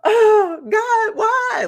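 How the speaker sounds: high-pitched imitated cry to God; retold anguished scream. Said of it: "like, screaming in the shower".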